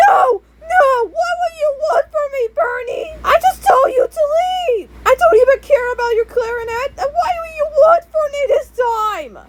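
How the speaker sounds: high, shrill acted yelling with a crying edge; hammed-up, high-pitched furious outburst; screaming mom. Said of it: "my mom just yelled and screamed".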